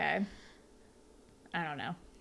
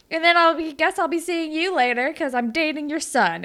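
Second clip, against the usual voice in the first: higher and louder, breezy; chirpy overconfident Holly J; 7 semitones higher, 5 dB louder than usual. Retold or imitated. Holly J telling the interviewers she's dating their son, chirpy. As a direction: drop the name smugly.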